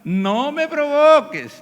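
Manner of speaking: high, loud, very drawn-out scolding; slow, heated parental threat; exasperated parent. Acted. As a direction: warn misbehaving children at breaking point. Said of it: "Cuando tus hijos se están portando mal".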